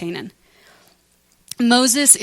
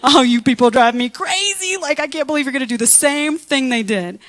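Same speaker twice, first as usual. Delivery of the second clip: louder, fed-up mock rant; exasperated Moses; 3 semitones higher, 4 dB louder than usual. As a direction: vent like a leader at the end of his rope. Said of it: "He's like".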